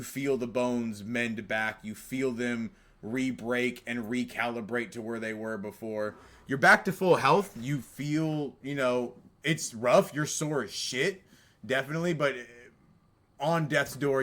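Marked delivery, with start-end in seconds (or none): 6.5-14.2 louder, higher, urgent narration; intense. A narrator's reading. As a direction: push the pain hard.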